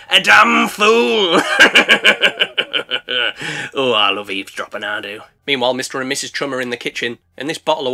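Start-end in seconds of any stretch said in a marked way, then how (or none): 0.1-1.4 drawn-out mocking shout of a shark; slow, loud gloat at a fool. Shark gloating over his rival, mocking shout. 3.7-5.2 low, smug purr of a sneaky shark. Acted. Eavesdropping shark admits its habit, smug low voice.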